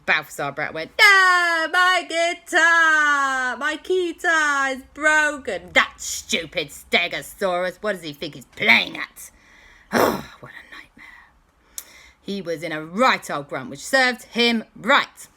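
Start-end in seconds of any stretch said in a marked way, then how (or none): 1.0-9.3 high, loud anguished wail; distraught villain. Acted. Villain's guitar smashed. 9.4-10.9 sighing whisper; despairing sigh. Acted. Villain sighs in despair.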